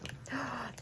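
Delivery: high, soft intake of breath; astonished gasp. Real, not acted. Crafter spotting a treasured item, astonished gasp. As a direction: catch your breath in astonishment. Spots item from missed convention.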